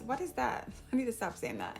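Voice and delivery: normal talking voice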